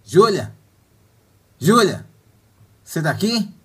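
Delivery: loud call across the room. Acted. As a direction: call her name loudly. Calling out for absent Júlia.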